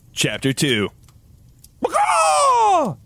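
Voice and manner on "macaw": shrieking high bird-like cry; dragon screech. Acted. Dragon calls out as the chapter opens, shrieking loud cry. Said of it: "the sound that dragons make".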